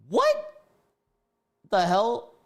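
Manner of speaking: high, loud shocked outburst. Real, not acted. Streamer reading creepy texts, shocked high outburst. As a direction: blurt it out in disbelief.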